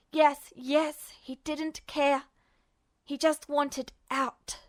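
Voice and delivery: ordinary talk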